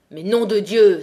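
a loud furious oath, the miner jolted out of his torpor; furious outburst. Acted. Maheu, dragged out of his despair, shouts in fury at his neighbour. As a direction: bark it out, fed up and enraged. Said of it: "s'écria Maheu, furieux".

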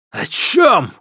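sharp question. A narrator's reading.

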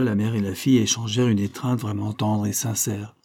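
ordinary delivery, nothing marked

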